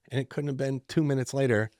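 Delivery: plain reading voice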